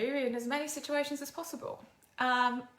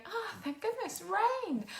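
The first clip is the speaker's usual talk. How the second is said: delighted relief; 10 semitones higher, slower than usual. Retold or imitated. Mock relief looking at rain.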